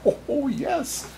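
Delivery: high chuckling oh yes; Santa chuckle. Acted. Santa chuckling at the bird's words, high. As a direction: laugh fondly.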